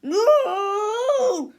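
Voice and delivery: high, loud shriek; scream. A narrator's reading. Melvin refusing again, screaming. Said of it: "screamed Melvin again".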